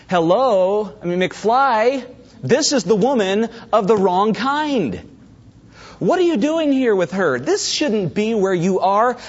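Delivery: high, slow, sarcastic "hello, McFly"; exaggerated disbelief at a fool; mock exasperation. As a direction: talk down to him like he's clueless. Mocking the foolish young man.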